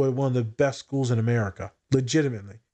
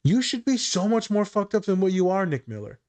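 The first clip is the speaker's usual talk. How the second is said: higher, lightly voiced Jess consoling Nick; a touch of Jess, up high, tender jab; quoting Jess's line; 7 semitones higher than usual. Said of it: "I stole this, these lines from Jess".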